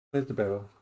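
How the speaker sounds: normal talking voice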